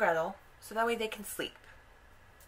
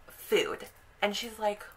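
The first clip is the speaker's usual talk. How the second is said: plain reading voice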